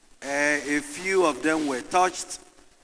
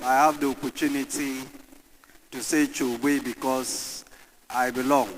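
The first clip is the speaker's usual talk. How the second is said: normal talking voice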